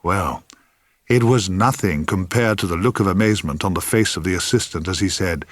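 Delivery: ordinary delivery, nothing marked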